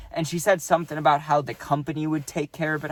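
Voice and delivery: normal talking voice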